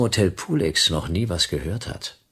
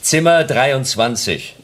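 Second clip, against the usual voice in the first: slow and raised, for the hard of hearing; raised, emphatic repeat; 7 semitones higher, 4 dB louder, slower than usual. Acted. Detective repeating the room number to a deaf porter, loud and slow. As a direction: spell it out loudly, word by word.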